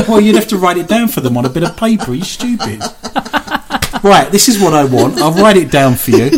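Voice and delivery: fast, loud, talking down; condescending trainer. Pretend. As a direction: explain it like they're dim. Bossing imagined insects about.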